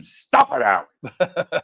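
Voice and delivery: mock-yell like a fed-up little grandmother; grandmother's yell. Grandmother to grandfather during his sneezing fit, imitated yell. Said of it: "she would yell at him".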